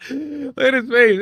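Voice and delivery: slow, high words through laughter; laughing while pointing at the screen. Real, not acted. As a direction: get it out between laughs. Still laughing at the clip.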